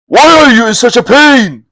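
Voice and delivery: a lightly voiced bandit leader demanding answers; alarmed chief. Bandit chief asking his frightened men, urgent.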